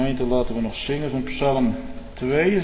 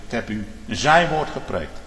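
normal talking voice

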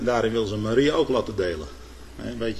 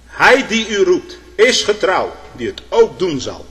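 higher, louder, slow proclamation; emphatic preaching climax; 6 semitones higher, 7 dB louder, slower than usual. Real, not acted. Preacher closing the sermon to the congregation, loud and slow. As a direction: proclaim it slowly with full force.

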